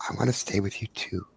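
soft breathy whisper; twinkling breathy fairy whisper. Acted. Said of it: "she literally whispers in your head".

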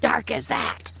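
low, quiet, creepy taunt from the dark; spooky hushed voice. Acted. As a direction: murmur it like a ghost in the woods. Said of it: "you hear, like, laughing".

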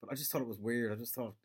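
usual speaking voice

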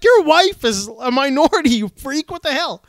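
high, loud outraged comeback; indignant retort. Retold or imitated. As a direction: fire back at a rude stranger, retold. Retort to a racist driver.

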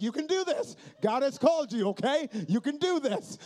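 high, loud cheering on of a nervous student; rallying a scared intern to preach; mock pep talk. Pumping up a scared freshman.

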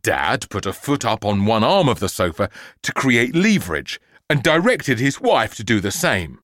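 plain reading voice

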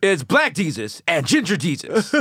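mock blockbuster trailer voice; movie trailer announcer. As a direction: announce it grandly, drawing out the title.

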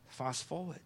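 breathy whisper of the slogan. Real, not acted. Preacher whispering his answer to the congregation, hushed. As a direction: whisper it like a secret.

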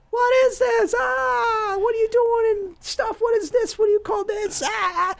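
mock-hysterical high-pitched screeching; shrieking girlfriend. Imitating a prankster's girlfriend finding the condom, hammed-up shriek. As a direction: squeal it like a horrified girlfriend. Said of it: "and she's like".

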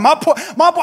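ordinary delivery

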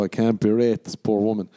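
normal talking voice

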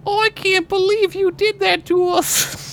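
high, drawn-out mock Australian; Australian accent. Podcaster imitating an angry fiancée, attempted Australian accent. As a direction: put on a squeaky, slow Aussie accent. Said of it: "try to do an Australian accent".

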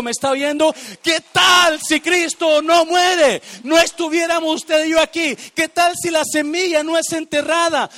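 loud, high, impassioned preacher's cry; preaching at full force. Acted. Pastor shouts to the church about the buried seed, impassioned preaching. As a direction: shout the gospel to the congregation.

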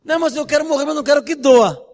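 high, loud pleading whine; mock whiny protest; imitated plea. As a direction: beg like someone afraid of pain. Retold Graciano pleading and backing off.

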